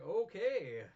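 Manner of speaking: loud high mock okay. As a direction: blurt the okay loudly in disbelief. Mock reaction.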